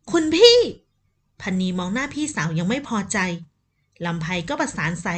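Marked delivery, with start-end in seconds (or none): 0.1-0.6 loud, high indignant protest; indignant cry. Acted. Younger sister cries out at elder sister, indignant and loud. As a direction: snap back at the elder sister, offended.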